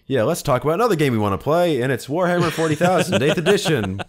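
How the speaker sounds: loud, excited announcement; pumped podcast intro; hyped. Real, not acted. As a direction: announce it like a big reveal.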